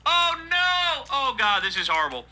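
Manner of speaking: high squeal of fake dismay; mock horror. Real, not acted. Host reacting to the article in mock horror. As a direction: shriek it like it's a disaster.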